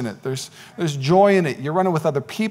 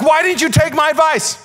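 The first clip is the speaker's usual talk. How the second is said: high-pitched indignant protest; exasperated; 12 semitones higher than usual. Acted. Foodie friend whose advice was ignored, exasperated. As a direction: demand an answer in a raised pitch.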